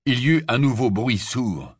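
ordinary delivery, nothing marked

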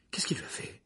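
soft, fearful whisper. Acted. Hermione kneels by Ron, whispering. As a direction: barely breathe the question. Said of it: "murmura Hermione".

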